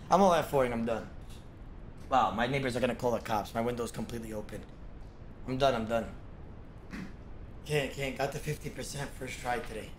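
usual speaking voice

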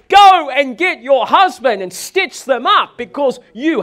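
rally her like a cheerleader; hammed-up urging shout. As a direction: salesman urging the wife to win over her husband, shouting. Salesman urges wife loudly.

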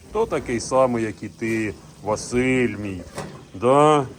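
usual speaking voice

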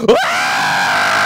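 sudden low loud wail of grief; wailing cry. Retold or imitated. Imitating mourners bringing in the body, loud wail.

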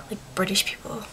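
soft whisper; whispered aside. Real, not acted. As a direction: drop to a whisper for "British people". Aside about British accents.

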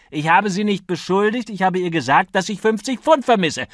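high, loud, indignant denial; heated protest. A narrator's reading. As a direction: defend yourself hotly. Acted quarrel, heated self-defence.

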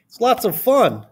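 high, upbeat schoolgirl voice; Emily Elizabeth. A narrator's reading. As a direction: pitch it up like an enthusiastic pupil. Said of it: "said Emily Elizabeth".

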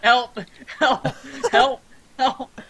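high, repeated yelps for help; panicked cries. Pretend. Burning player begging friend for help, panicked cries. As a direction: yelp for rescue in mock panic.

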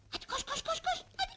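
high, hurried urging; eager high-pitched prodding; excited urging. Acted. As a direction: hurry the child along, pitch climbing. Urging daughter to run to father.

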